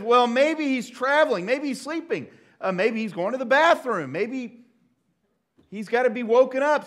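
high-pitched, loud mock speculation; Elijah's sarcasm. Elijah mocks the prophets' absent god, high sarcasm. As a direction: needle them with put-on helpful suggestions. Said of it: "using the spiritual gift of sarcasm".

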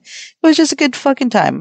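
high, gushing delight; excited. Real, not acted. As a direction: rave about a great night. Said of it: "I'm telling you".

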